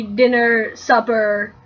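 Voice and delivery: ordinary talk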